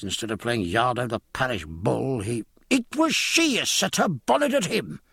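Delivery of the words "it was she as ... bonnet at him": high-pitched interrupting wife; indignant cry. A narrator's reading. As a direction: cut in with a much higher voice. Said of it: "cried Missus Williams".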